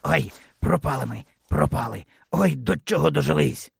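understated fearful moan of despair; frightened fox. A narrator's reading. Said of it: "злякався лис". (тихе перелякане голосіння лиса)

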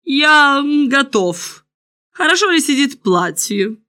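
preen aloud, drawing the words out; lifted, deliberate kingly reply; self-satisfied king. A narrator's reading. King replies, showing off.

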